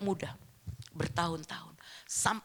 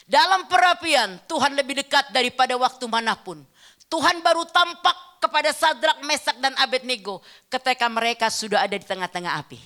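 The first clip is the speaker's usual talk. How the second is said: loud, emphatic sermon delivery; forceful preaching; 4 semitones higher, 8 dB louder than usual. Real, not acted. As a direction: proclaim the scripture to the whole congregation. (khotbah lantang dan tegas)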